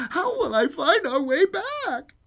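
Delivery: high, slow, fretful wail; acted childlike fright in a high voice; frightened little girl. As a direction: plead like a scared child. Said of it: "she was suddenly frightened again".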